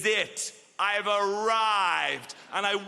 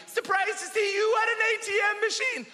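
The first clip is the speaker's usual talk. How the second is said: much higher shouted taunt; mock shout. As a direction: yell it from a passing bike. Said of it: "he shouted at me".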